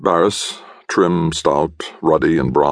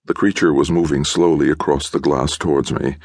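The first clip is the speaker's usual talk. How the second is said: ordinary delivery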